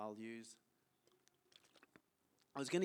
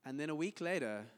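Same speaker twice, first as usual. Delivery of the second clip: ordinary talk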